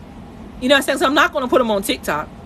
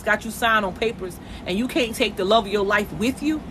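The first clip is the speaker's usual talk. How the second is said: ordinary talk, nothing marked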